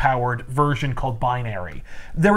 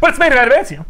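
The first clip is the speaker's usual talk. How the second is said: indignant protest; 9 semitones higher, 5 dB louder, faster than usual. Pretend. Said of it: "playing Wolverine in pitch session".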